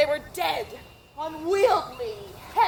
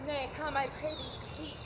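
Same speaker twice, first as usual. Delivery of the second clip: hushed, fading entreaty; quiet pleading; 4 semitones lower, 10 dB quieter than usual. Acted. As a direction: beg softly, almost under breath. Juliet pleading with nurse.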